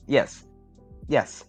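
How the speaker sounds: ordinary talk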